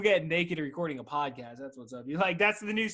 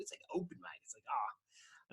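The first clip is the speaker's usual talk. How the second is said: mock whisper; 4 semitones higher, 11 dB quieter than usual. Mocking the name open mic.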